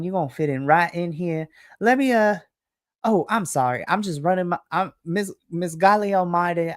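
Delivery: a syrupy Southern welcome, honey and darling; Southern lady. Acted. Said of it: "This is very Southern, like, lady".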